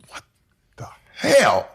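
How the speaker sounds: high-pitched, incredulous outburst; exasperated disbelief. Retold or imitated. Son retelling mother moving back in, incredulous outburst. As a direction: let disbelief burst out on the last word.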